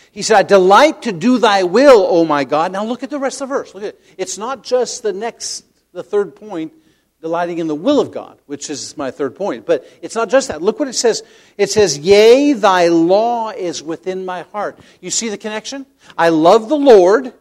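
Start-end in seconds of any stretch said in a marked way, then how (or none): 0.4-2.7 loud, slow, raised declamation; emphatic scripture reading. Real, not acted. Preacher reading the psalm to his congregation, emphatic declamation. 12.0-14.8 loud, raised, drawn-out proclamation; emphatic scripture reading. Real, not acted. Preacher showing congregation the rest of the verse, emphatic declamation.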